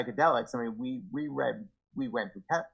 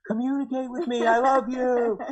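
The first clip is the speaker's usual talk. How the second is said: high, strained mock yell with a laugh; husband mocks how he once screamed at his wife; mock screaming plea; 11 semitones higher, 5 dB louder than usual. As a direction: shout the plea, half laughing at yourself. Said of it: "I'm screaming at her".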